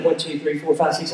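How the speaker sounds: low, soft acted head count; counting under breath. Dad counting sleeping kids on the bus, under his breath. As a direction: count to yourself, dropped low and quiet.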